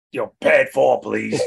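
a touch of a gruff stepdad; deep taxi passenger voice. Retold or imitated. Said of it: "'cause he would go".

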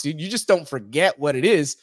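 usual speaking voice

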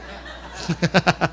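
short run of quick chuckles; laugh. Real, not acted. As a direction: chuckle at your own joke. Bromea sobre el hermano de enfrente. (risa corta y rápida)

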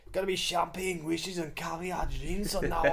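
giggling Scottish parody; mock Scottish. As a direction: tease it in a put-on brogue through laughter. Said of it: "It just sounds like".

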